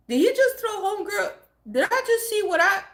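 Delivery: high-pitched stunned questions; shocked. Retold or imitated. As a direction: retell it in incredulous disbelief. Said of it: "like, shocked".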